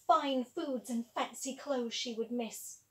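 ordinary delivery

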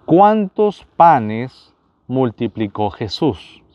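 each word drawn out, very slow; slow quiz question. Shown as a demonstration. Quiz host to viewers, asking the question very slowly. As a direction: spell out the question slowly for the audience.

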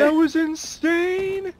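high drawn-out amazed cry; amazed exclamation. Real, not acted. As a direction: stretch it out in awe. Teammate reacting to snipe.

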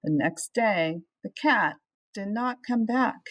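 ordinary delivery

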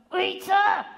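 loud high call across room. Acted. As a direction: shout her name to reach her. Calling out to Rita.

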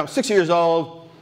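ordinary talk

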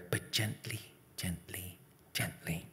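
fading into a soft whisper; gentle hush. A narrator's reading. Boy petting Killer gently, hushed whisper. Narrator softens while petting brother.

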